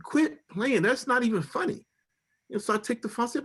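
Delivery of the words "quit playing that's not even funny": high-pitched, incredulous scolding; urgent protest. Retold or imitated. Husband telling wife to stop joking, high and incredulous. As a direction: plead up high for her to stop. Repeats protest at fake call.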